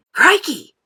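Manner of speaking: loud, high crikey; surprised exclamation. A narrator's reading. Mike sees the squirrels, loud and amazed. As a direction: blurt it out amazed. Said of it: "said Mike T V".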